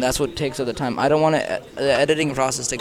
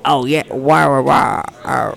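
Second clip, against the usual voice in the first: louder lopsided cartoon dad voice; Peter Griffin voice; 8 dB louder, slower than usual. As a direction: talk out the side of the mouth. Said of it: "I saw someone do an impression for that".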